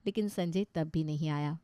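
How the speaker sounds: ordinary delivery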